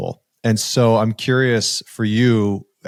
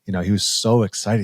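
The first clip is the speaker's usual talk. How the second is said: ordinary talk, nothing marked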